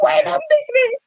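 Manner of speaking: very high, squealing double take; surprised. Retold or imitated. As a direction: shriek it as the surprise hits. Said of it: "I was like".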